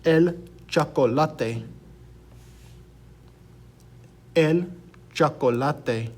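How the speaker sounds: chocolate as cho-ko-LAH-tay, slowly; Spanish pronunciation. Shown as a demonstration. Teacher gives students an example word for che, slow demonstration. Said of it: "a word that uses this".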